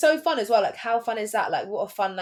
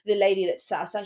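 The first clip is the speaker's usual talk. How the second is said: ordinary talk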